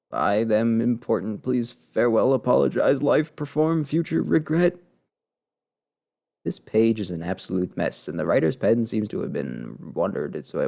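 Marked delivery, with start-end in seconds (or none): 0.1-4.8 disjointed words climbing steadily higher; unravelling diarist. Acted. Player voices the heroine losing her grip, unravelling. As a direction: build from level to high, word by word. Voicing scattered last diary words.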